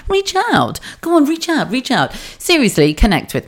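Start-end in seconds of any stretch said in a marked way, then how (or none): 0.1-2.1 high, falling "Reach out", savoured. Shown as a demonstration. Enjoying her own phrase to listeners, sing-song high "Reach out". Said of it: "I really enjoyed that reach out".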